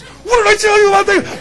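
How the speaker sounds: high furious yell at a recruit; a drill sergeant losing it over a thank-you. Retold or imitated. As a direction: shout it right in his face, way up high. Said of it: "he's going nuts".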